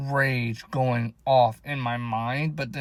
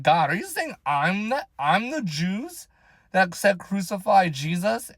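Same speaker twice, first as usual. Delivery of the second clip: higher, slow incredulous question; acted-out astonished asking, pitched up; retold disbelief; 5 semitones higher than usual. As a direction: question God in mock shock, stretching the words. Acts out questioning God in disbelief.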